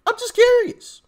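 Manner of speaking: faux-innocent curiosity. Real, not acted. Asking about her net worth with mock innocent curiosity.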